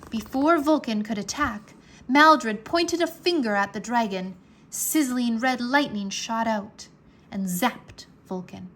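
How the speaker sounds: plain reading voice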